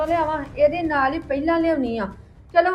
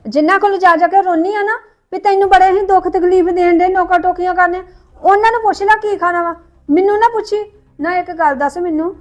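much louder furious scolding; angry shouting; 2 semitones higher, 8 dB louder than usual. Acted. Sister-in-law shouting at the younger woman, furious. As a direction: lash out at her.

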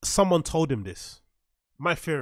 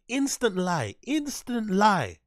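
"Instant lie" higher, louder and slow, with weight on "lie"; drawn-out mocking callout; 6 semitones higher, 4 dB louder, much slower than usual. Shown as a demonstration. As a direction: stretch "lie" out as a slow, loud mocking verdict. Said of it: "Instant lie, said three times".

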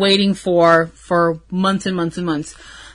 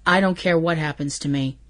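quiet earnest quote; 5 semitones lower, 8 dB quieter, faster than usual. Retold or imitated. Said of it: "And he says".

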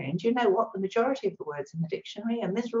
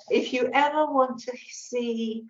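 normal talking voice

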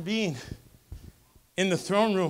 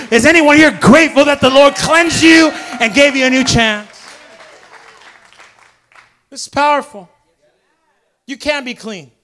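loud, higher, driving sermon climax; full-force preaching; 6 semitones higher, 15 dB louder than usual. Acted. Pastor urging the congregation to come clean, loud fervent preaching. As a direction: preach it out at full power, pressing the crowd.

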